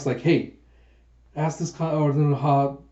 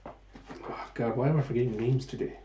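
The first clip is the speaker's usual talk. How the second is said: much softer, breathy, fast self-reproach; muttered aside; 7 dB quieter than usual. Real, not acted. The narrator muttering to herself about forgetting names. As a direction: grumble at yourself under your breath.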